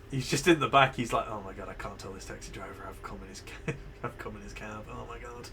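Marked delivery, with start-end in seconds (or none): 1.3-5.5 low, soft mock confession to himself; acted inner panic. Player voicing a man hiding it from the driver, hushed mock dismay. Voices the passenger's private thought.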